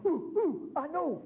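put-on a kid bursting to be called on, hand up; eager Horseshack. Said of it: "the student is always raising his hand".

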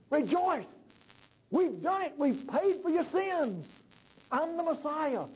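high, exultant proclamation; triumphant Jesus. Acted. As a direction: announce victory in a high, ringing voice. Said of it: "And Jesus says".

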